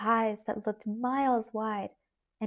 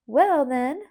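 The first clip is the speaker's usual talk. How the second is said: peppery twang; 3 semitones higher, 7 dB louder than usual. Acted. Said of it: "in her peppery twang".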